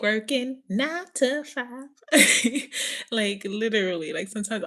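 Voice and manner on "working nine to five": slow, higher country-accent imitation. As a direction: put on a drawn-out country twang. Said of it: "I feel like I'm, like, [name]".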